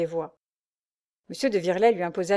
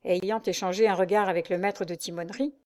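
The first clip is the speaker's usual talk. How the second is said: ordinary delivery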